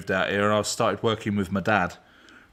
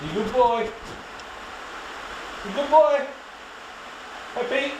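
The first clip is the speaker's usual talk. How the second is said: very high, doting pet voice; praising the dog; 14 semitones higher than usual. Real, not acted. Owner praising his dog, high doting voice.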